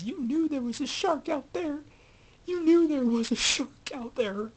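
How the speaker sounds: high, loud, accusing yell of a grieving mother; furious accusation. Retold or imitated. Grieving mother accuses Brody over her son, high loud furious yell. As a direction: hurl the blame at him at the top of your voice. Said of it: "she was upset about her boy being dead".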